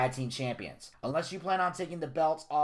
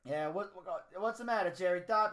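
lightly voiced mobster Don; Tony D; 7 semitones higher than usual. The Don announces his battle royal, brash. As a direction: play a brash Italian-American Don.

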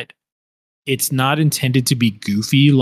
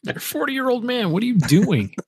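put-on high teasing child; taunting kid, higher; 9 semitones higher than usual. Mocking kid's voice.

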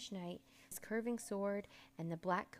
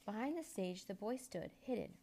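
ordinary delivery

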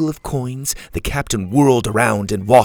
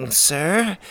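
high, timid query; nervous crewman piping up; 8 semitones higher than usual. A narrator's reading. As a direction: ask the boss nervously. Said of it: "cleared his throat nervously".

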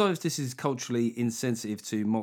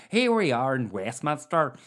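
lightly put-on Northern Irish political reporter; political reporter impression. Comic recalling a TV political reporter, mock reporter voice. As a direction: mimic an old-time Westminster correspondent. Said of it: "who was that political reporter".

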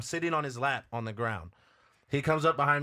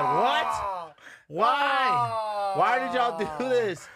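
high, loud disbelieving cry; shocked outburst; 8 semitones higher, 4 dB louder, much slower than usual. Retold or imitated. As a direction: re-enact shocked protest, voice shooting up. Reacts to being first out.

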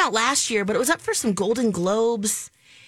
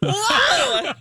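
shocked shout; 12 semitones higher, 4 dB louder than usual. Real, not acted. Shock at deranged movie.

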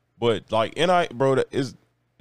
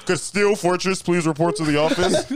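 slow laughing mock PA call; school intercom announcement; 4 semitones higher than usual. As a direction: call him down like the school office.

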